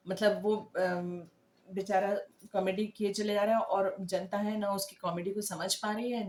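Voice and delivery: ordinary delivery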